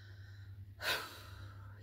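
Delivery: long soft defeated sigh. Acted. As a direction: let out a hopeless breath. William slumps, hopeless.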